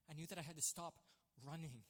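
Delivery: ordinary talk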